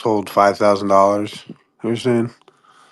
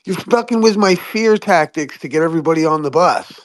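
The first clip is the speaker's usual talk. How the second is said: high snapping mock shut-down; hammed-up bully silencing the doubter; annoyed alarmist; 7 semitones higher than usual.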